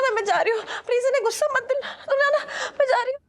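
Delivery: high-pitched, hurried plea; flustered pleading. Acted. Woman begging the men not to anger him, flustered pleading. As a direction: beg urgently as you back away.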